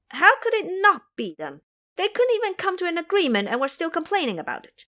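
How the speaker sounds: high, indignant, pushing fast; insistent boy. Acted. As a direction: insist they're guilty. Ming Yue insists.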